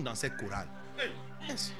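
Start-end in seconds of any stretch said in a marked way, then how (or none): none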